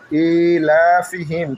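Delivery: ইলাফিহিম chanted very slowly; very slow Quranic chanting; slow recitation. Shown as a demonstration. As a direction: draw the word out in recitation. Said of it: "recites the verse word".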